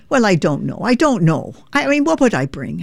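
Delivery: puzzled Irish mum asking about a potluck; Irish mother. Retold or imitated. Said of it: "my mother would say".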